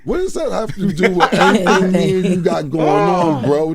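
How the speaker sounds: loud, high-pitched disbelief; incredulous protest. Real, not acted. Guest answering a friend's dig as the room laughs, incredulous.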